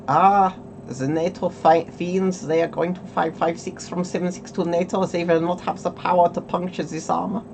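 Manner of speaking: mock German accent, gloating over NATO rounds. Streamer mocking East German armor makers, hammed German accent. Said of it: "that is my German accent".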